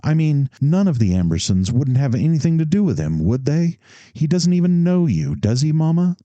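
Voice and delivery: put-on childish voice. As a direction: plead like a keen little boy.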